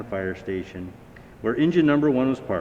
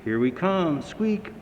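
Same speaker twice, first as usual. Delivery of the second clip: cheery call ending in a very high squeak; the bell announcing itself; 6 semitones higher than usual. A narrator's reading. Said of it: "called the bell".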